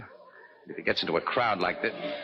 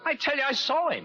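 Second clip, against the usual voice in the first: insisting. Acted. Casey insists, fat man denies.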